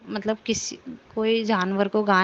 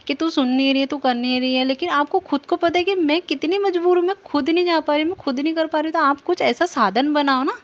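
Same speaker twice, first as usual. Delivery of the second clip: retold telling-off, pitch climbing high; worked-up remonstrance, higher as it builds; indignant scolding; 5 semitones higher, 4 dB louder than usual. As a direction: protest to the guru, heated and pleading. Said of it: "गुरुपा को भी डाँट लगाई".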